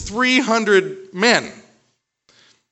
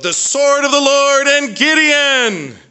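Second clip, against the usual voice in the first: loud, high, slow war cry; the three hundred yell out to the Midianites, a booming battle cry; 10 semitones higher, 8 dB louder than usual. Acted. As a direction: roar it out like an army charging at night. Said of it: "and they yell out".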